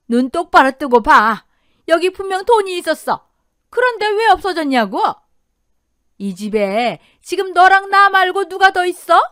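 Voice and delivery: furious shout, pitched high; loud, high-pitched raging interrogation; abusive outburst. Acted. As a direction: scream the accusation at her. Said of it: "갑작스러운 폭언에".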